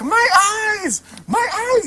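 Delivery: high-pitched mock yell of a kid clowning around; goofy kid wailing. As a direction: wail it like a playful little boy. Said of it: "he's, like, trying to be funny".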